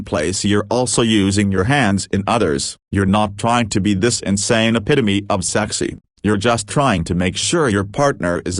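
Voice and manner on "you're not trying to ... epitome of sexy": ordinary delivery, nothing marked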